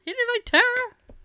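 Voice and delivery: squeaky high mock scolding; high mock outrage. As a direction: pitch it way up, indignant.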